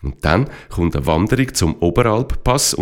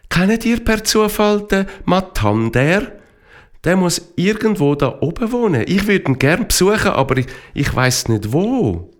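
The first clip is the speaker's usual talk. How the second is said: put-on lightly higher curious girl; girl's voice; 9 semitones higher than usual. Darelina asking the jackdaws, light and curious. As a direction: ask the birds sweetly.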